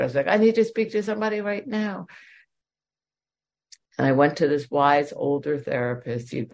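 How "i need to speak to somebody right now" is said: urgent, pleading retold request; desperate plea. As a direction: beg for help right now, pitched up. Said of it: "I was desperate".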